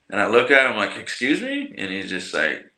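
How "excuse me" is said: high, questioning retort; retold "who, me?" reaction; taken aback. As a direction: pitch it way up in disbelief. Retelling his reply to staring officer.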